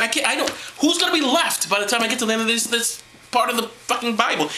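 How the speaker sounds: can't believe the body count; exasperated disbelief. Real, not acted. Exasperated outburst.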